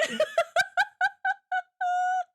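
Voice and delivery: a burst of real high laughter. Real, not acted. Retelling the friend calling mom dude, bursting out laughing. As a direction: crack up at your own story.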